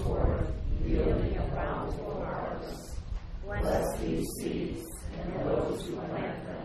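soft, reverent blessing prayer. Acted. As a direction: pray quietly over the seeds.

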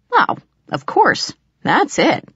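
high, slow sarcastic drawl; mock agreement, dripping sarcasm. A narrator's reading. Sarcastic reply to jealousy.

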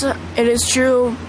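normal talking voice

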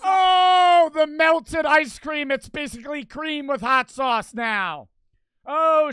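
loud, high, slow shout; excited shout. Real, not acted. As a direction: yell it out in shock. Streamer yells at the reaction.

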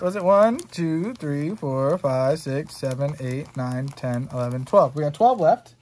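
normal talking voice

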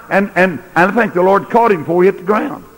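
high, loud ringing preacher cry; preaching at full force. Acted. Preacher tells the congregation Moses died strong, a ringing cry. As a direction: lift it to the rafters in triumph.

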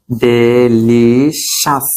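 ডেলিশাস very slow, "de... li... shas"; ডেলিশাস drawn out in three separate beats; syllable-by-syllable demo. Shown as a demonstration. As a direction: stretch each syllable of ডেলিশাস, ending in "-shas". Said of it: "সি আউস না".

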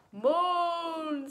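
high, drawn-out spooky moan; long playful groaning call; mummy moan. A narrator's reading. Story mummy mother moans.

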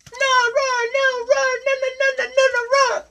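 extremely high squealed refusals; protesting little dinosaur. Acted. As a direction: squeal a tantrum between roars.

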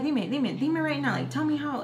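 high, insistent demand without real shouting; mock scolding yell. Cousin demands answers from the little girl, mock scolding. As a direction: imitate a cousin pressing for an answer. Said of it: "just, like, yelling at her".

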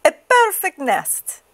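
high, slow hen voice; slow, high chicken delight. Acted. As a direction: savor the find like a proud chicken. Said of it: "she cried".

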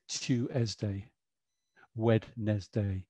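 "Tuesday" as Tues-day and "Wednesday" as Wed-nes-day, slow and sounded out; spelling voice. Shown as a demonstration. Teacher showing how parents say spelling words. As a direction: sound out every letter of "Wednesday". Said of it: "We say".

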